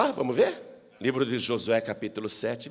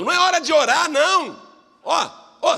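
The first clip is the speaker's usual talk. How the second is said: high, loud stern rebuke; God rebuking; 11 semitones higher, 5 dB louder than usual. Acted. God rebuking Joshua for praying, stern and loud. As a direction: scold him to get up. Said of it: "disse o Senhor a Josué".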